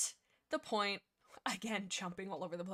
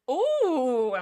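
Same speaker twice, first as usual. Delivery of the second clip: loud, high, drawn-out squeal of ooh; excited "ooh"; 6 semitones higher, 13 dB louder than usual. Real, not acted. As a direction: let out a big delighted ooh. Delighted by own shipping idea.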